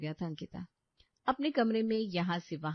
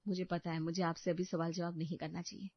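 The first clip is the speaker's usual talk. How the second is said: quiet restraint; 2 semitones lower, 7 dB quieter than usual. Acted. Wife quietly confronts husband.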